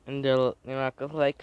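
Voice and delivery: plain reading voice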